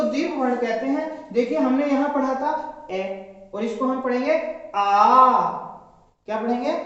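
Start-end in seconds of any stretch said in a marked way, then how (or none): 4.8-5.6 आ held long and loud as a two-beat vowel. Shown as a demonstration. Teacher demonstrating a long vowel, आ drawn out. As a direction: stretch आ out for two counts, louder. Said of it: "तो उच्चारण हमने किया आ".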